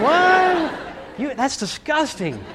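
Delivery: put-on high, slow shocked kid voice; disgusted kid. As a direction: recoil in mock disgust. Kid reacting to eaten taffy.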